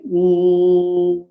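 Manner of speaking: a long even hum, lips closed; humming demo. Shown as a demonstration. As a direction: hum mm as long as you can. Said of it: "Then you're gonna just say".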